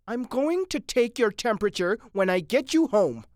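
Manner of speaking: high, loud pronouncement; bossy wife. Acted. Mrs. Mitty announcing her verdict on Mitty, bossy. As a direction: lay down the law to a husband.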